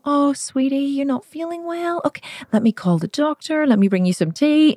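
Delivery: high, sugary mock-caring mum voice; high cooing imitation of a caring mum; mock doting mum. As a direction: coo it like an overly sweet mother. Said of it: "she certainly wasn't the kind of mum who would have gone".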